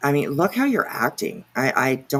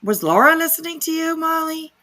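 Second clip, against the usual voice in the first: mock sing-song question; 11 semitones higher, 3 dB louder than usual. Real, not acted. Mocking question to Molly in a live.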